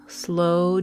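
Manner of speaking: usual speaking voice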